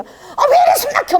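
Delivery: high-pitched squeal of protest; comic, high mock protest; protesting mother-in-law. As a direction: imitate someone squirming in a smothering hug. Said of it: "꽉 껴안으니까 우리 어머니가".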